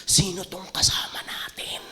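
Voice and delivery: frightened whisper; terrified whisper. Retold or imitated. Disciples whispering to each other, terrified. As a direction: breathe it in fear. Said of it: "asking each other".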